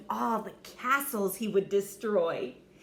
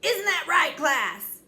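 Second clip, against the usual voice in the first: coach yelling; 7 semitones higher, 8 dB louder than usual. Acted. Coach addresses class.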